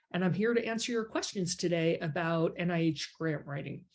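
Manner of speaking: usual speaking voice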